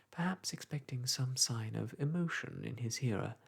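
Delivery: usual speaking voice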